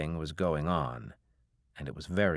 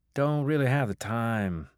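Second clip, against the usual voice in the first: airy; 5 semitones higher, 3 dB louder than usual. A narrator's reading. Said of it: "he said airily".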